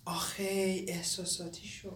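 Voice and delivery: slow, hushed whisper; whispered aside. Acted. Young man aside as the old man chokes up, whispering. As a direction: whisper it so he won't overhear.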